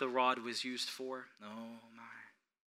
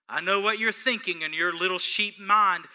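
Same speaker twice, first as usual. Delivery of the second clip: normal talking voice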